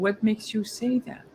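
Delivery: soft, even question; calm, unemotional. Shown as a demonstration. Agent asking the person in front of them, calm and unemotional. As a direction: ask it gently, without any argument in it. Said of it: "without even becoming animated and emotional".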